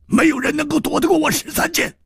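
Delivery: high, fast, menacing boast; fierce boast. Acted. Killer boasts of his sword to an opponent, high fierce boast. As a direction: throw it out quickly, pitched right up.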